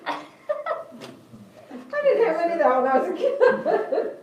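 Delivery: laughing hard while talking, pitch jumping up. Real, not acted. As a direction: let real laughter break up the words. Laughs at pupils' cookie answer.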